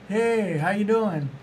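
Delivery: a hint of an energetic celebrity greeting a fan; Gary Vee's greeting. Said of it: "And he's like".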